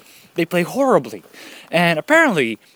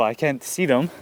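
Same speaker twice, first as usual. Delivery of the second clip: ordinary talk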